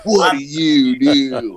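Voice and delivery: drawn-out, slow menace; slow menacing movie voice. Retold or imitated. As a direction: lean on each word, slowly. Slower repeat of movie line.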